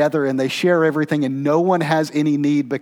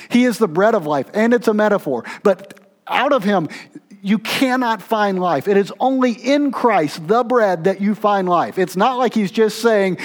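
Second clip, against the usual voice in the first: higher, louder preaching push; impassioned preaching; 7 semitones higher, 4 dB louder than usual. Real, not acted. Preacher pressing the congregation, impassioned and loud. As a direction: drive the point home from the pulpit.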